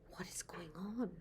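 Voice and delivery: hushed puzzled question; whispering. Real, not acted. Grandmother wonders at picture.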